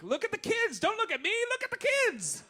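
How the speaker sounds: high mock diva pleading. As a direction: squeak it out like a flustered diva deflecting. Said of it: "she's looking around being like".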